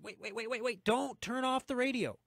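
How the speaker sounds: high, playful pleading; hammed-up high-pitched begging; mock-urgent plea. Begging listeners not to tune out.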